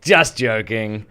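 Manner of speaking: loud, drawn-out mock "only kidding"; big imitated punchline delivery; imagined punchline. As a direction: belt it out slowly, like a comic reveal. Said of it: "waiting for her to be like".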